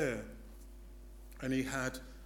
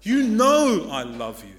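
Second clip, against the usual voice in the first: loud, higher exasperated cry; indignant outburst; 5 semitones higher, 13 dB louder than usual. Acted. Peter indignant at Jesus, loud exasperated retort. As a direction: snap back, fed up. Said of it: "Peter's indignant".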